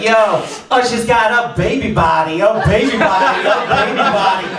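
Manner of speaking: high-pitched teasing jeer; mock taunt. Acting out his heckle of [name].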